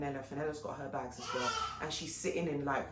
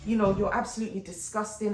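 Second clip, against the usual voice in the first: angry retort; 5 semitones higher, 7 dB louder than usual. Retold or imitated. Telling off the host.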